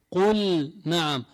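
قل نعم slow, the lam of قل sounded before نعم; clear lam in qul na'am. Shown as a demonstration. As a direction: say قل نعم with the lam fully pronounced. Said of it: "حكمها الإظهار". (إظهار اللام في قل نعم)

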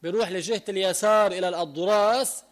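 ordinary delivery

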